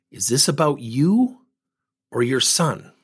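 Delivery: slow, measured question with a pause; calm, slowed down. Retold or imitated. Coach settling an upset mother, calm and slow. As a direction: bring it down and ask slowly. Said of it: "And I calmed my voice down. I slowed down".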